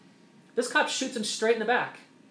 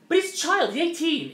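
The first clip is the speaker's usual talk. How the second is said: much higher, louder insistence; forceful insistence; 10 semitones higher, 4 dB louder than usual. Real, not acted. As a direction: hit it hard, rising passion. Heated argument.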